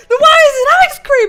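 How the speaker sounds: loud, very high, rapid squawk; exasperated protest. Retold or imitated. Arguing with husband over the word for ice cream, exasperated. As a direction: demand an answer in a high, incredulous yell.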